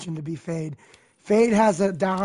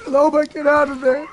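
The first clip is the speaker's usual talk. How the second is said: higher, louder warning call; urgent call; 6 semitones higher, 4 dB louder than usual. Real, not acted. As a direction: call her out of danger. Yelling to teammate Loba.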